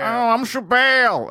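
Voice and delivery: hammed-up, slow second-hand impression; Dan Soder's Chappelle. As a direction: draw it out as a bad impression. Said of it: "me trying to do Dan Soder Chappelle".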